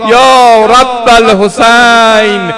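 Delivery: loud, high, keening chant of grief; mourning preacher crying out to God in prayer; wailing lament. Acted. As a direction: cry it out over the crowd at full force. Full-force wailing lament, prayer.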